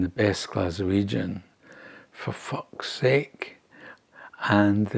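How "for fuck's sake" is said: whispered, drawn-out curse in despair; hushed curse. Retold or imitated. Grandfather pinned down under fire, whispering a slow curse. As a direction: breathe the swear out slowly, almost voiceless. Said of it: "rolled on his back and swore".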